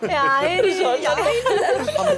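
slow drawn-out gleeful cry; delighted. Acted. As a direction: stretch it out with glee. Delighted after he leaves.

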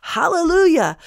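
high, ringing cry of praise; exclaimed praise. Acted. Great multitude praising God over and over, exclaiming. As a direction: sing out the praise in a spoken voice. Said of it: "Over and over, they're saying".

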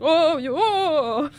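high, loud mock moaning; mock character voice. Reader mocking his own confession voice, high and wailing. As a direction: exaggerate the voice in a drawn-out wail. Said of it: "that's like the voice is like".

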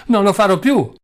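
high, loud desperate cry. Acted. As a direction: scream it out, begging.

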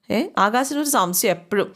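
ordinary delivery

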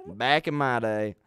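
a hammed-up old man reminiscing; old-timer. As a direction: drag it out like a grumpy old man.

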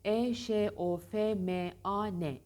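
E Ş O F M A N, slow letter by letter; spelled out slowly. Shown as a demonstration. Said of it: "Eşofman".

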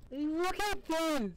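very high, drawn-out squeal of amazement; excited squeal. Real, not acted. Gamer marvelling at the cat animation, excited squeal.

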